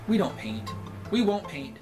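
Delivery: usual speaking voice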